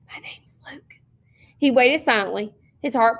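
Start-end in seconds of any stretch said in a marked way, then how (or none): 0.1-0.8 very soft, voiceless secret confession; soundless whisper. A narrator's reading. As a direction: whisper it into the dark, barely audible. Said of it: "he whispered soundlessly in the dark".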